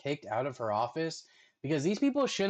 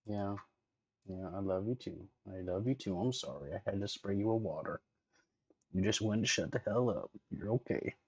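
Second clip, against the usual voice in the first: tender to dog; 4 semitones lower than usual. Real, not acted.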